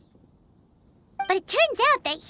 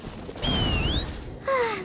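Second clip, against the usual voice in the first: long tired sigh, letting air fall out; weary sigh; 5 semitones higher, 4 dB quieter than usual. Acted. Paimon sighing after the noble's rebuff, weary sigh. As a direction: sigh like the task just got harder.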